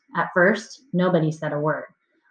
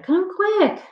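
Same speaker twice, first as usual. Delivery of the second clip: urgent, high-pitched call; hollering. A narrator's reading. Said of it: "I hollered".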